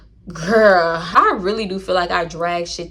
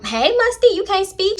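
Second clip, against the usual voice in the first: high, bright imitation of her hailing him; mock girly greeting; 11 semitones higher than usual. Imitating the woman greeting Musty, high and sing-song. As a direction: squeal it up high like she's teasing him. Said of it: "And she was like".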